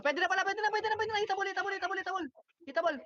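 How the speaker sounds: high-pitched hyped chase call; excited callout. Real, not acted. Shotcaller urging teammates to chase, high and excited. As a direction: urge the team on, sky-high and thrilled. Calling a chase mid-game.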